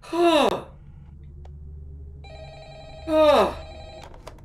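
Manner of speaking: long breathy exhale, high-pitched onset; shaken gasp. Real, not acted. Player recovering from a scare, shaken gasps. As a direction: let out big gasping breaths.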